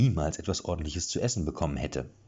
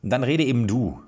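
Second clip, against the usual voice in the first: loud, impatient order; forceful demand; 5 semitones higher, 6 dB louder than usual. A narrator's reading. Erik pointing his sword at Jan, loud and impatient. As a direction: push him to talk.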